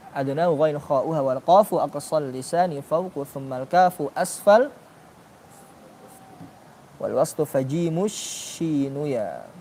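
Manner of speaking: slow, measured chant of a tajwid poem; recited verse. Shown as a demonstration. Teacher reciting a tajwid verse to the class, slow and measured. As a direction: recite it slowly like a memorised lesson.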